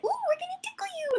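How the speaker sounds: very high and quick; playful tickle threat. Retold or imitated. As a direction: tease like a game is starting. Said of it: "I sound ridiculous when I'm doing sessions".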